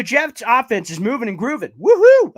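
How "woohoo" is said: loud, high celebratory whoop; excited whoop. Real, not acted. Fan cheering the Jets drive, loud excited whoop.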